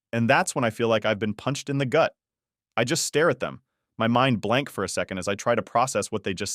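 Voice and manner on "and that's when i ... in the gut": plain reading voice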